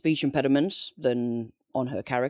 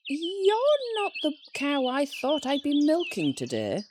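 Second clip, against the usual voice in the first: high startled squeak of surprise; surprised; 11 semitones higher than usual. Acted. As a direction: caught off guard, pitch jumps way up. Comic skit opening, surprised milker.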